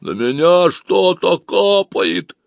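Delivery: slow worried voice from a tube. Acted. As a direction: sound concerned, from below. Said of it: "озабоченным тоном сказал из трубы".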